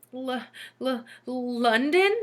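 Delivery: stammering, rising, unsure; nervously. A narrator's reading. Frightened Leslie guessing, nervous stammer. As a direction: stutter the guess in fear. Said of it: "Leslie said nervously".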